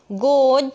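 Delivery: "गोड" loud with a long stressed vowel. Shown as a demonstration. Teacher shows the class a stressed syllable, long "गोड". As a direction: lean hard and long on "गोड". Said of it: "long, इसमें क्या लगा? Stress लगा".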